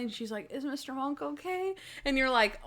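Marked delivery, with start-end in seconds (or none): 0.5-1.7 high, soft imitation of a worried woman; mock pitiful concern. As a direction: ask feebly in a small raised voice. Voices injured Natalie asking after Monk.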